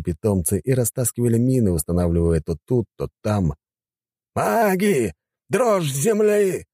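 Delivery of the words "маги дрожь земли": high drawn-out shouted command; shouted order. A narrator's reading. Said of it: "приказал командир". (приказной крик)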